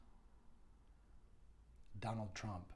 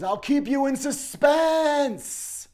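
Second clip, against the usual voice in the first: very high, loud, slow drawn-out sinister taunt; menacing taunt; 24 dB louder, much slower than usual. Retold or imitated. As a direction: stretch every word out to threaten. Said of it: "And he says".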